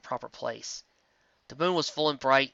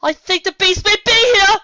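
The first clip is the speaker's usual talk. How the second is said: high, loud alarmed shout; loud frightened cry; as loud as she could; 15 dB louder than usual. A narrator's reading. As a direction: yell the warning across the beach. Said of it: "speaking as loud as she could".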